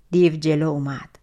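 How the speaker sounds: plain reading voice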